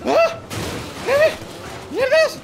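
high, loud startled yelps; startled shriek. Real, not acted. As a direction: jump out of your skin. Startled by an explosion in the game.

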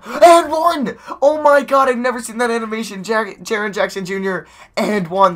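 high, loud delighted yelling; excited yelling. Real, not acted. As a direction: shout it in disbelief and joy. Celebrating an and-one.

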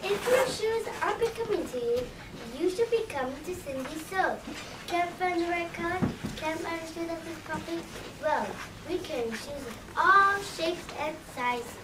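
upbeat commercial voice selling slippers; ad read. Acted. Shoe store ad selling slippers to listeners, upbeat pitch. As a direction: pitch it like a radio spot.

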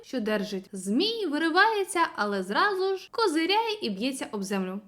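plain reading voice

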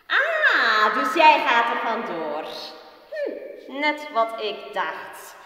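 loud, high, eerie taunting voice; mocking voices in Peer's head jeering that his mother is dead; spiteful voices. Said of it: "Valse, gemene stemmen".

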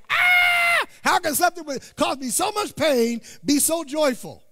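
loud high cry of wonder at the newborn; amazed new parent. Retold or imitated. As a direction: shout it out, overwhelmed with joy.

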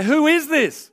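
high, loud astonished question; awed terrified cry. Retold or imitated. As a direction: gasp it out in stunned fear. Terrified disciples after the calm.

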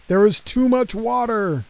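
loud, firm policeman warning. Acted. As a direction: call it out over the rain. Policeman warns stuck drivers.

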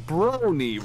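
high, loud, falling exclamation; Anthony C. Retold or imitated. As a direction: lift the pitch and let it drop. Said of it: "and then he goes".

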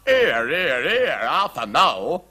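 agitated, slow common-voiced king; flustered, forgetting to sound regal; flurried king. A narrator's reading. King stopping the queen, flustered and unregal. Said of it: "cried the King".

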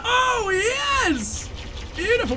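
high gleeful cheers; delighted squeal. Real, not acted. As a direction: crow over the kill. Delight after a kill.

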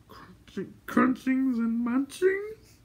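very high, slow creature whimper, rising; questioning whimper. A narrator's reading. Gurgi asking for food, whimpering questioningly. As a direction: start faint, then whimper a hopeful question. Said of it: "Gurgi whimpered questioningly".

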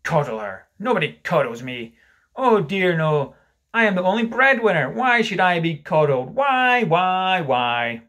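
loud, indignant tirade building to a cry; shouted. Acted. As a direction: rant with rising force, hammering each why. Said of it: "he shouted".

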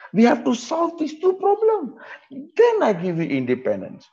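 high mock British accent voice; British officer. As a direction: put on a posh colonial Brit. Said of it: "British kata".

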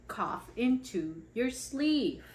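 normal talking voice

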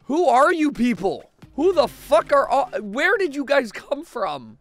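high, loud bewildered yelling; loud startled confusion; alarmed. Real, not acted. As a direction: demand who these people are. Strangers appear in house.

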